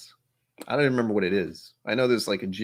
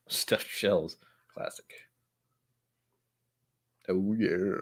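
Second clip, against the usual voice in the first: odd voice; 3 semitones lower, 5 dB quieter, much slower than usual. Real, not acted. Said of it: "I don't know why I said it in that voice".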